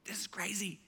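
whispered in awe; awed whisper among the churchgoers; hushed crowd whisper. Retold or imitated. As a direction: breathe it out like a stunned crowd. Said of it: "the crowd is like".

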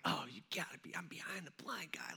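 whispered under his breath, stuck behind someone in a hallway; a hushed aside that nobody else should hear; whispering to himself. Retold or imitated. Student stuck in a hallway whispers to himself, voiceless. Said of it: "I'm like".

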